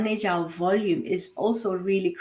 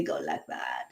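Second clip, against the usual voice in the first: low, soft creaky fry; glottal fry; 12 dB quieter than usual. Shown as a demonstration. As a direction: let the voice creak like a squeaky hinge, out of breath. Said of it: "a glottal fry or a squeaky hinge".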